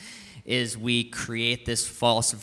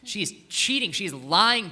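louder, slow, bitter accusation; angry outburst; 8 semitones higher, 7 dB louder, much slower than usual. Retold or imitated. Preacher reliving his anger at the liar, angry. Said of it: "I felt some anger".

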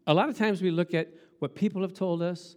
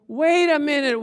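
sudden realisation; 8 semitones higher, 6 dB louder than usual. Retold or imitated. His own sudden realisation acted out.

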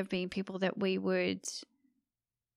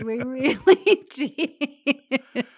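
laughing; 6 semitones higher, 9 dB louder, much slower than usual. Real, not acted. Laughing over the explorer videos.

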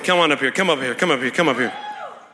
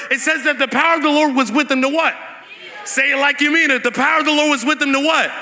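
raised, driving pulpit voice; impassioned preaching; 4 semitones higher than usual. Real, not acted. Preacher urges the church to pull on healing power, impassioned. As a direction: preach it high and insistent, hitting key words.

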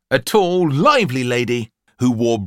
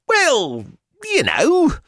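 high, hesitant mother voice; high-pitched, coaxing and unsure; flustered mother; 4 dB louder, slower than usual. A narrator's reading. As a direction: grope brightly for an explanation. Mother flounders explaining fun.

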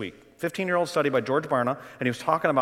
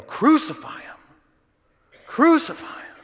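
much higher, loud mob chant of each line; crowd yelling; 13 semitones higher, 4 dB louder, slower than usual. Retold or imitated. Crowd at Pilate's palace demanding crucifixion, high frenzied yells. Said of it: "those same people yell".

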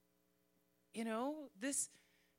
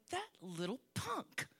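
higher, slowed mock grumble at being tricked again; a rueful self-mocking complaint; mock frustrated self; 9 semitones higher than usual. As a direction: playfully whine about getting fooled. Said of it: "I would walk away going".

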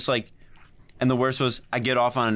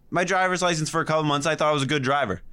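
ordinary delivery

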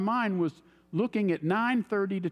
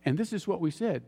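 ordinary talk, nothing marked

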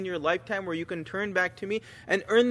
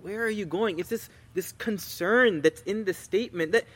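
usual speaking voice

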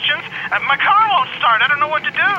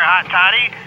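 normal talking voice

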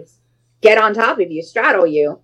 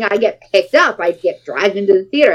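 normal talking voice

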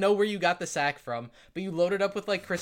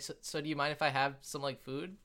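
timid; 3 semitones lower, 7 dB quieter than usual. Acted. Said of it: "she's like".

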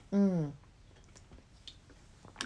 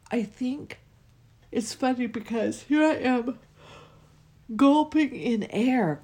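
high and loud, words stretched by a yawn; 6 semitones higher, 5 dB louder than usual. Real, not acted. Podcaster laughing at herself while yawning, words on a yawn. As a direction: yawn right through the words. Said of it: "gulping in air with my yawns".

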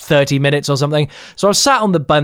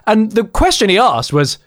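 usual speaking voice

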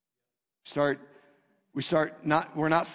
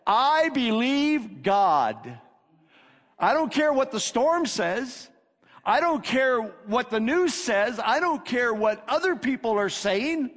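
slow, loud, high proclamation; bold declaration; 9 semitones higher, 5 dB louder, slower than usual. Retold or imitated. Paul declaring faith to the people on the ship, loud and slow. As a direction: ring it out defiantly. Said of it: "here's what he said".

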